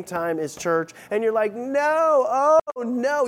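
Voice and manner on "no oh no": high, louder groaning oh no; a hammed-up wail of dismay; theatrical despair at the bad news; mock dismay. Acted dismay at soccer schedule.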